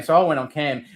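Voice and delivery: ordinary talk, nothing marked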